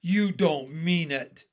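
loud, drawn-out astonishment; disbelieving cry. A narrator's reading. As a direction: cry out as if you can't believe it. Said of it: "they cried".

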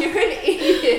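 high, loud retold retort through laughter; slow, amused telling-off; laughing exasperation. Her to partner joking about first aid, laughing exasperation.

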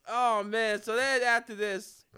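ordinary delivery

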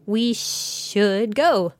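very slow, hissing snake-woman voice. Retold or imitated. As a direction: stretch every s into a long hiss. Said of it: "speech has a lot of hissing".

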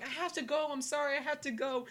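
high-pitched mock apology; apologetic player. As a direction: call back a hurried, hammed-up excuse. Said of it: "was looking at the dugout like".

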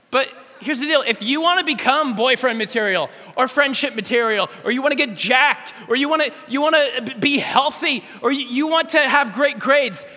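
full-force sermon climax; fiery preaching. Acted. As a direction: preach it hard, hammering each goal.

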